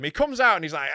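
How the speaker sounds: normal talking voice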